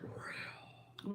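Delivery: breathy whispered ending. Real, not acted. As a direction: drop to a whisper. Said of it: "I'm losing my voice".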